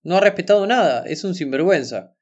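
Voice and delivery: ordinary delivery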